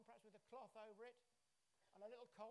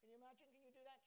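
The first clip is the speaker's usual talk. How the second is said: ordinary delivery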